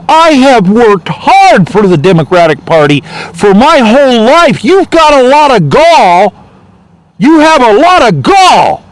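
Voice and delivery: high, slow indignant tirade; drawn-out, high-pitched mock indignation; imitated outrage. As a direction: act out the scandalized party loyalist. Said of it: "some of these people were really upset".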